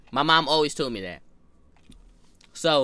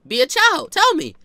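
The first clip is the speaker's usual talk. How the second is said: mock shout; 11 semitones higher, 7 dB louder than usual. Kid mocking adult's demand.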